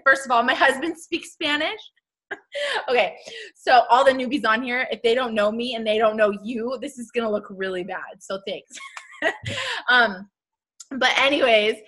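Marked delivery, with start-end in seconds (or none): 2.3-2.8 short high, soft laugh; high giggle. Real, not acted. Embarrassed over the accent joke, short high giggle. 9.2-9.8 high, softer burst of laughter; short laugh. Real, not acted. Laughing off looking bad to newbies, short high laugh. Laughs after awkward thanks.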